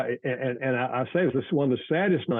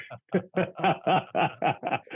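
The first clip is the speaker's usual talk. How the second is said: laugh; 7 semitones higher than usual. Real, not acted. Host jokes about cereal.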